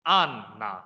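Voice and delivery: Anna as long, heavy AN, short light na; first-syllable stress. Shown as a demonstration. Teacher modelling Russian Anna, first syllable stressed. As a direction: stretch the An of Anna, then drop na. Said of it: "第一個音節就要重嘅".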